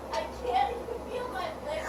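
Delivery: high whispered mock of a drunk. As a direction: echo her slur back, teasing. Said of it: "All I hear is".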